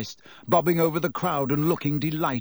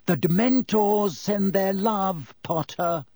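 a sneering call across the crowd, slowed and raised; mock farewell called out, pitched up; taunting shout; 6 semitones higher, slower than usual. A narrator's reading. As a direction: jeer it at Potter from a distance. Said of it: "who shouted through the crowd".

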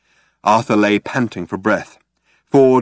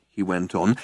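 plain reading voice

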